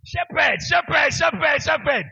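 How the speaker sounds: loud, high chant of vindication; triumphant shouting. Real, not acted. Preacher winning a pronunciation bet with congregation, triumphant shouting. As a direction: crow it over and over at the losers.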